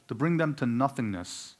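ordinary talk

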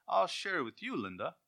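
high, bright mock-generous child voice; hammed-up generous kid; sweet sharing sibling. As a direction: sound sugary and giving. Sibling sharing cake again.

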